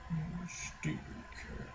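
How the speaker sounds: whispered scolding. Real, not acted. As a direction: hiss a scold at the cat. Scolding cat softly.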